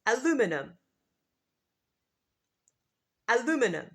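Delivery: aluminum as a-LOO-mi-num, four syllables; American aluminum; American pronunciation. Shown as a demonstration. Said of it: "in American English, the pronunciation is".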